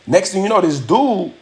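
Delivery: ordinary talk, nothing marked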